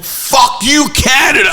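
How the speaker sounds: high, mock-shouted chant; imitated announcer. Retelling what he kept yelling, imitated high shout. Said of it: "he kept like".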